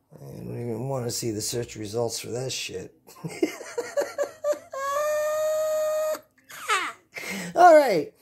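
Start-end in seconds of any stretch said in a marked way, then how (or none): 3.2-6.2 very high shrieking laugh. Real, not acted. Big laugh after joke. 7.5-8.1 giddy. Real, not acted. After laughing fit.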